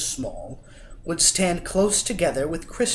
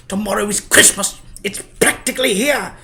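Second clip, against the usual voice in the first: Grinch snarl; 6 semitones higher, 9 dB louder, faster than usual. Acted. Grinch voiced in read story.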